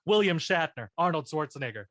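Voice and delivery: normal talking voice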